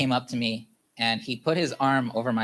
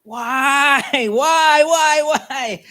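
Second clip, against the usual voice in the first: anguished full-voice scream, much higher and louder; screaming why; 13 semitones higher, 9 dB louder, much slower than usual. Retold or imitated. After the breakup, screaming at the top of his lungs. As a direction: howl the question out in raw pain. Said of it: "at the top of my lungs saying".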